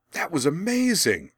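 put-on high, loud, thrilled young boy; excited boy cry. A narrator's reading. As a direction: burst out in boyish wonder. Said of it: "the boy cried".

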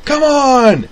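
very high loud holler. A narrator's reading. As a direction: holler at the crowd. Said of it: "the Crunch and Munch vendor hollered".

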